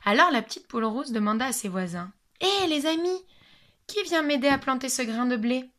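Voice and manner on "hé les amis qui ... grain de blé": higher, bright call to friends; little hen calling her friends for help. Acted. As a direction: call out to the neighbours cheerfully. Hen calls her neighbours.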